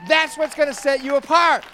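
loud, high, hammering each don't; full-force preaching. Acted. Pastor exhorts the congregation not to be sad, full-force preaching.

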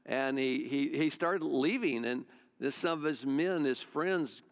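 ordinary talk, nothing marked

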